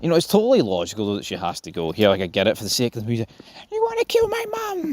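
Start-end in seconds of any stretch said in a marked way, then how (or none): 3.7-4.9 much higher mock wail; mock crying. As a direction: squeal it as a hammed-up tearful son. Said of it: "And he's crying".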